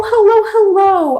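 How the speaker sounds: ordinary talk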